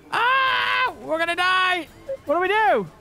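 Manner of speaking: high mock panic yell; panicked scream. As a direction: scream like the plane is going down. Plane crashing in game.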